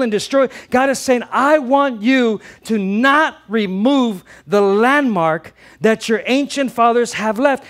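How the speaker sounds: loud, high, driving sermon voice; fervent pulpit preaching; preaching at full force. Acted. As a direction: press the point home to the congregation.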